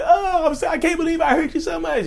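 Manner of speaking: high, sugary mock-sorry voice of a manipulator; fake apology.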